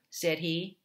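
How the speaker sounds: ordinary delivery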